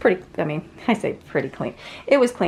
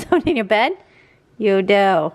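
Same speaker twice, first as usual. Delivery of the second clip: usual speaking voice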